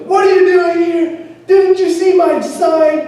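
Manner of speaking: high, loud mock angry homeowner; upset lady. Telling off a door-to-door student, high and shrill-voiced. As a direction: squeal it like the cross lady at the door.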